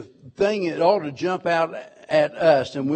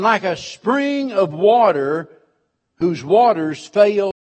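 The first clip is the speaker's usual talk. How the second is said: louder, higher, fervent sermon build; rising preaching; 3 semitones higher, 4 dB louder than usual. Real, not acted. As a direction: lift the congregation toward the promise.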